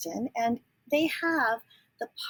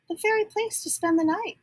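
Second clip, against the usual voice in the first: lightly voiced duck, pitched higher; a chirpy duck voice, a touch raised; quacking Mister Mallard; 10 semitones higher than usual. A narrator's reading. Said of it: "quacked Mister Mallard".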